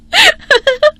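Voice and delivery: very high gloating laugh; shrieking giggle. Acted. Bride laughs at her rival, very high. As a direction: crow with laughter.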